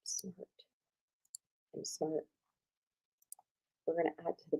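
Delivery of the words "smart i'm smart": self-praise muttered. Real, not acted. Muttering to herself while fixing slides.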